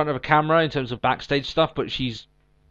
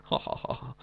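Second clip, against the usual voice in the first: fake laugh; 13 dB quieter than usual. Mock laugh after quote.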